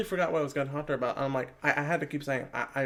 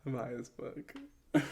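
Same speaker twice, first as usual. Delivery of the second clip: mouthed; 3 semitones lower, 8 dB quieter, much slower than usual. Real, not acted. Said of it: "I mouthed it".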